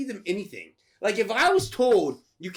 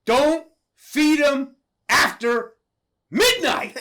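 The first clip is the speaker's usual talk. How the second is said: "don't feed him after midnight" slow and loud, pitched up, with pauses; grave emphasis; 5 semitones higher, 6 dB louder, slower than usual. Retold or imitated. Grandson giving the last rule, "don't feed him after midnight" slow and emphatic. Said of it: "which is most important".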